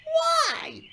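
very high, loud shriek; shrieked, indignant demand. A narrator's reading. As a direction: wail the question high in outrage. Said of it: "James shrieked".

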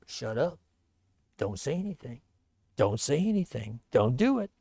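low, soft urgent command to stay silent; hushed order. Acted. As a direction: hiss it out quietly. King orders silence on the wall.

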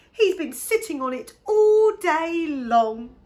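high, very loud, drawn-out exclamation; excited cry. A narrator's reading. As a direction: exclaim it loudly and slowly in amazement. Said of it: "cried Posy".

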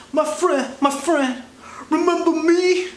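a hustler spotting his mark again; high mock vendor crying out my friend; eager street vendor.

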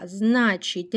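ordinary delivery

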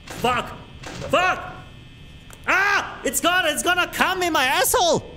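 scared out of his wits; frightened yelling. Real, not acted. Monster approaching in horror game.

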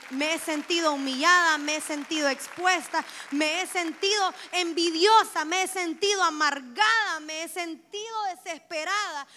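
loud, rising preacher's cry; impassioned sermon climax; preaching at full force. Real, not acted.

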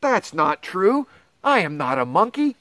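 usual speaking voice

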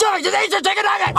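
high-pitched exasperated shout; fed-up parent shouting; exasperated order. Acted. Parent losing patience in restaurant quarrel.

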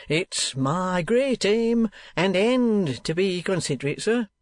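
pompous, wheedling schoolmaster. Acted. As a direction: sell the school like a smug showman. Squeers boasting to a stranger.

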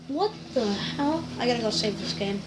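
ordinary delivery

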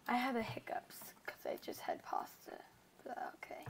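breathy whispered aside; whispering. Real, not acted. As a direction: whisper it under your breath. Quiet aside about hiccups.

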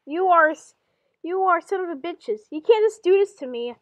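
hammed-up indignant outburst; acted angry protest. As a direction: protest loudly as the wronged one. Acted tirade resumes after apology.

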